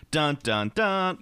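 sung-out "dun dun dun", climbing on the last; mock dramatic sting. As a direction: fake a suspense soundtrack cue.

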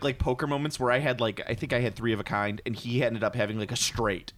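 ordinary talk, nothing marked

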